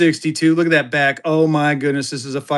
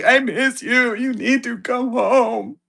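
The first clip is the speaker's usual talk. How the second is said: mock whimper, higher and slow; whiny baby; 6 semitones higher, slower than usual. Calling wife and kid, a mock whiny homesick plea. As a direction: beg like a homesick little kid. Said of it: "'cause I am a baby".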